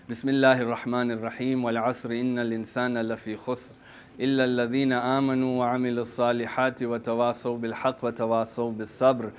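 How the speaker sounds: fast, quiet run-through of Surat al-Asr; rapid Quran recitation. Real, not acted. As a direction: rattle off the closing sura softly.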